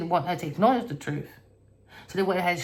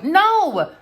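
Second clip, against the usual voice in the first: loud no; 11 semitones higher, 7 dB louder than usual. Real, not acted. Rejects the sympathy vote.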